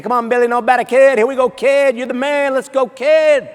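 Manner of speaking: Little League chatter, pumped up; infield chatter. Retold or imitated. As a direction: cheer the pitcher on like an eager eight-year-old. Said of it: "doing that chatter thing".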